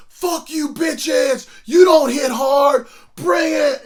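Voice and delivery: high, full-volume taunting yell; drawn-out shouted dare, acted out loud; re-enacted defiant shout. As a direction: holler a challenge at the whole team. Re-enacts defiant yelling at whole team.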